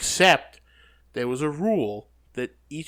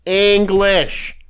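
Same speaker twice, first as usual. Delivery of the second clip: loud, high exasperated yell; exasperated shout; 7 semitones higher, 15 dB louder than usual. Real, not acted. Cohost insists he spoke clearly, shouting it. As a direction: bark the word at him.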